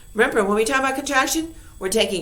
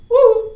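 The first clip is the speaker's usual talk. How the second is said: excited woo; 13 semitones higher, 11 dB louder than usual. Real, not acted. Excited cheer mid-lesson.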